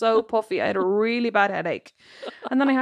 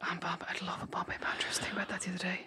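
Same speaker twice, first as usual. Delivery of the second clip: whisper; 3 semitones lower, 16 dB quieter, faster than usual. Real, not acted. Dreamy whisper about takeaway.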